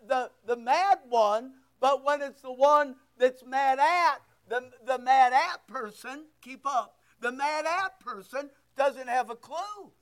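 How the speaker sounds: normal talking voice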